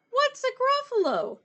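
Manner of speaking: surprised owl. Acted. Owl startled by the word Gruffalo, high surprised question. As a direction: squeak it up in surprise.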